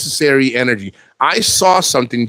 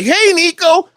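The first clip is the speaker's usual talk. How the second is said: high sing-song "Hey, Nico"; mock fan greeting; 3 dB louder, slower than usual. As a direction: pitch the hello way up, sing-song. Mocked fan greeting again.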